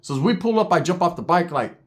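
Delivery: ordinary delivery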